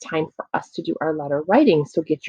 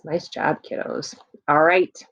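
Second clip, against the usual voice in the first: ordinary talk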